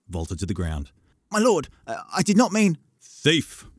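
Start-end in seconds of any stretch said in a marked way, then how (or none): 1.3-2.8 put-on a much higher, stammering boy caught out; flustered boy. A narrator's reading. 3.0-3.7 stern. A narrator's reading. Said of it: "trying to sound stern".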